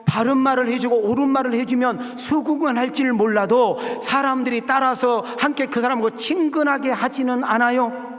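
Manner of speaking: loud, high preaching voice; preaching at full force. Acted. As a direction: drive the point home to the congregation. (힘있게 외치는 설교)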